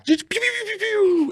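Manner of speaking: very high mouth-made laser shots; squeaky rapid pew-pew noises; imitated gunfire. Man repeating gun gag as callback, high pew noises.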